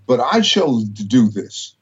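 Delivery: usual speaking voice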